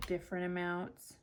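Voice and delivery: ordinary talk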